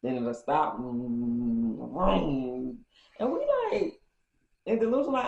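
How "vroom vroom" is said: engine vroom. Retold or imitated. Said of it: "the car is going vroom".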